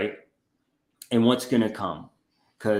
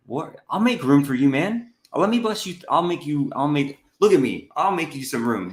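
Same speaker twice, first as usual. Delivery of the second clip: higher, louder, hyped-up offer to protect him; playful mock bravado; 7 semitones higher, 3 dB louder than usual. Joking with an older man in the buffet line, higher and louder hype. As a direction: puff up like you'll clear a path for the big guy.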